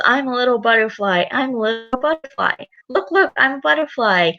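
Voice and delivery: put-on playful piggy girl showing off her butterfly; little Peppa. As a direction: chirp it like a small proud girl pig. Voices Peppa reading aloud.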